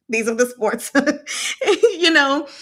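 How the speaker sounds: laughing through the words. Real, not acted. Reviewer cracking up at the prince's sports, laughing. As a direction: giggle while listing the sports.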